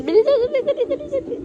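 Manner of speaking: high, sing-song urging; high coaxing. Real, not acted. Mother urging her baby on, high sing-song.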